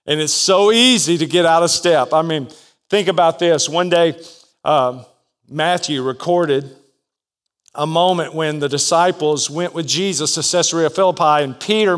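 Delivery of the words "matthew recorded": usual speaking voice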